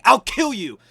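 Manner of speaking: loud, high mock yell; imitating a shouted threat; retold threat. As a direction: yell it like an enraged attacker. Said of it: "he's like".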